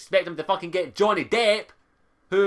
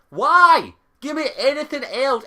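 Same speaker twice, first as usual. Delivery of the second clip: loud, higher exasperated cry; exasperated outburst; 6 semitones higher, 7 dB louder than usual. Real, not acted. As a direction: burst out with real exasperation. Exasperated rant about the game's music.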